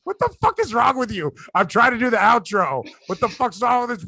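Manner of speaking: high exasperated yell at a friend; mock outrage. Co-host interrupts the outro.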